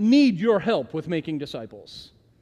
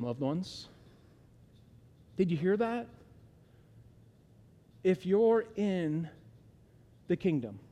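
quiet sermon aside; 6 dB quieter than usual. Acted. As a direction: lean in and speak gently. Drops to intimate quiet.

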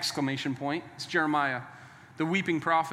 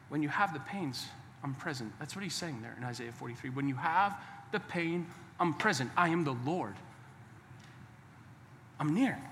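soft, low, tender reassurance with long pauses; hushed preaching; 4 semitones lower, 10 dB quieter than usual. Real, not acted. Preacher voicing God's comfort to those in pain, hushed. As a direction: drop far down in volume and let each phrase settle.

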